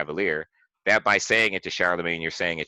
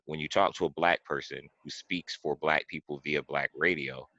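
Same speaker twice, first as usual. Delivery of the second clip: ordinary talk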